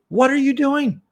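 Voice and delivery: very high, pleading mother's voice; a discarnate mother defending her son, voiced high and pleading; pleading spirit mother. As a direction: beg them to stop, high and earnest, slowing toward the end.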